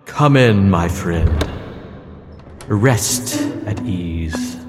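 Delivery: ordinary delivery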